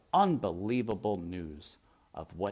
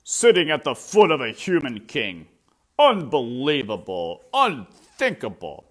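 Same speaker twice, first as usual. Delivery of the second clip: loud, higher outraged ranting; outraged shout; 4 semitones higher, 11 dB louder than usual. Acted. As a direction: rant in loud disbelief. Lester still shouting.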